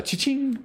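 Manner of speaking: high sung register ding; cash register cha-ching. Pretend. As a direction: imitate the till.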